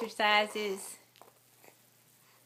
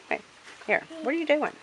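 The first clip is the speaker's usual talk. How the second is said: ordinary delivery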